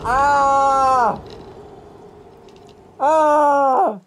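high, held cry of frustration; frustrated yell. Real, not acted. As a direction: let out a long exasperated "ah". Frustrated cries after dying.

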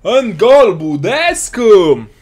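a big, high, loud goal shout, stretching the name; goal celebration. Real, not acted. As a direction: roar the goal and drag out the scorer's name. Goal scored in the match.

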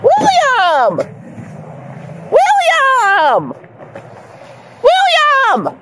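high, drawn-out call falling away. Real, not acted. Mom calling for her runaway son, calling out. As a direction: call after someone who has run off.

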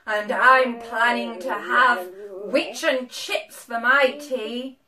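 loud, slow, menacing dragon voice; mean dragon. A narrator's reading. Dragon planning to eat witch for tea, menacing. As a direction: boom it out slowly and menacingly. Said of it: "I am a dragon, as mean as can be".

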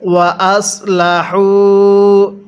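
ওয়া আসলাহু slowly with the heavy sad s; careful tajweed ওয়া আসলাহু; correct sad sound. Shown as a demonstration. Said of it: "এটাকে সৎ পড়ছি আমি".

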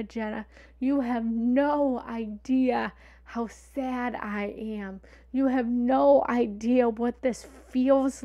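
a mother crying over her daughter's ex, imitated slow and loud; imitating mother crying. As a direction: play the tearful mother, drawing out every word. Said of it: "but she called me crying".

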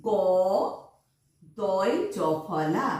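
দয় জফলা drawn out very slowly; slow spelling. Shown as a demonstration. Teacher spells দয় জফলা slowly for the children. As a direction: spell it out syllable by syllable. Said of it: "spelling out".

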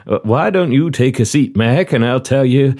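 put-on courteous Southern-accented interviewer; genial southern commander. Commander offers the applicant a seat, genial southern role. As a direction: play a hospitable commander running an interview.

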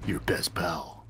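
put-on old smoker voice. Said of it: "I apparently sound like an old smoker".

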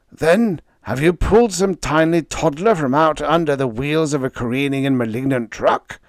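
high, loud mocking screech; sarcastic screech. A narrator's reading. Leo sarcastically screeching at Freddie over the match. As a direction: hurl the question in a sarcastic shriek. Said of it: "Leo screeched".

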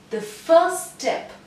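"The first step" drawn out slowly and high. Shown as a demonstration. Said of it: "so this was slow".

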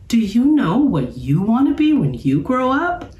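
put-on high little-girl voice, curious; a young cub asking her brother; lightly voiced young sister. A narrator's reading. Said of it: "Sister was thoughtful".

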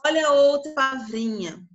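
ordinary talk

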